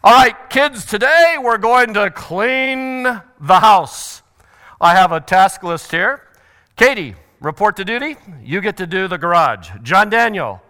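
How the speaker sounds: loud, commanding dad handing out chores; lion dad. Acted. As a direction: call the kids to order, loudly. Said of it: "the lion is the guy who does what".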